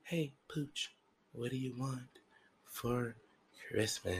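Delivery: very soft, put-on questioning voice; hushed role-play of someone asking; imagined gift-giver asking. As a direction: ask it softly, drawing out the last words. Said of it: "I was to be asked".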